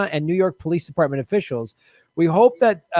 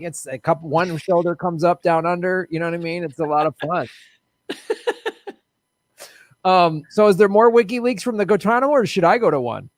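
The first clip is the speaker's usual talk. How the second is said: ordinary talk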